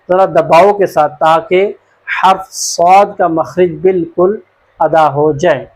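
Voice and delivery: ordinary delivery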